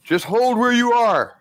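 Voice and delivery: high, strained character voice; stranger's voice. Acted. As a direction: warn them off as a hammed-up stranger. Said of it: "and he says".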